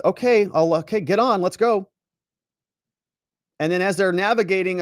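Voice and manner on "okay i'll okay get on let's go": raised, louder reluctant agreement; frog giving in. Acted. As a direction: play the exasperated frog finally relenting. Voices frog finally giving in.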